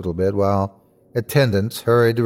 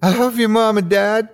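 high, overwhelmed declaration of love; emotional outpouring; 10 semitones higher than usual. A narrator's reading. Boy runs to his parents, high and emotional. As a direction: pour it out high, breaking with emotion. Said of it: "breaking down emotionally".

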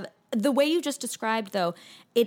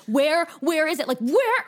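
rising, higher frantic demand; mock panic building to a high peak; frantic search; 6 semitones higher, 4 dB louder than usual. Hunting the house for her water bottle, frantic and rising. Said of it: "I'll be like".

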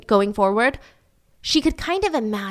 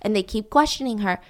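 ordinary talk, nothing marked

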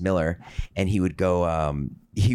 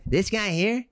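lightly voiced, higher teasing coworker, laughing mid-line; imitated coworker; 14 semitones higher than usual. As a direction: tease him with a higher, mock-sly voice. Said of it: "your impression is, like, pretty spot on".

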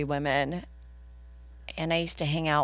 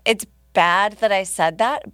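ordinary talk

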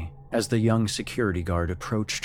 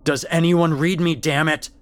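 much higher and louder, angry at the silence; frustrated shout; 9 semitones higher, 7 dB louder than usual. Acted. Guard shouting at a dead radio, frustrated. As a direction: yell it into the radio.

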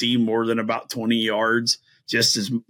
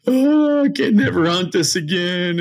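high, loud mock wail; mock crying; 9 semitones higher, 5 dB louder than usual. Hunter losing his favorite stand, mock crying. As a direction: wail it out as if in tears, hammed up. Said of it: "you're, like, crying".

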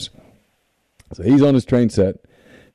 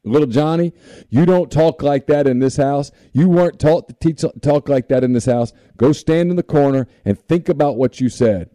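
scolding mom; 5 semitones higher than usual. Retold or imitated. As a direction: scold the boy like an angry mom. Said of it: "she says".

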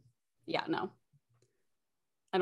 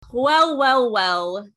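theatrical well well well; 9 dB louder, much slower than usual. Acted. Dramatic show opener.

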